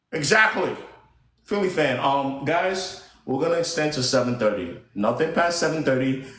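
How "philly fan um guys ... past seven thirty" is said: normal talking voice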